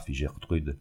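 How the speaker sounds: ordinary delivery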